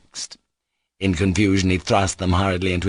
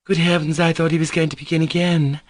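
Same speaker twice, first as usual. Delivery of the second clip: nervous exclamation; 8 semitones higher than usual. Acted. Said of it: "exclaimed nervously".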